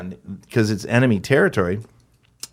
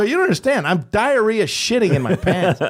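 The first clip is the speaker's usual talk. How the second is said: high, pleading mock fright; comic panic; 10 semitones higher than usual. Scared actor confessing to the pilot, comic panic. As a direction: plead in hammed-up terror.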